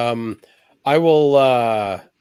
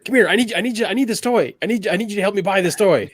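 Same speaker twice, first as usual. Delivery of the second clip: calling out; 7 semitones higher than usual. Acted. Player calls friend over excitedly.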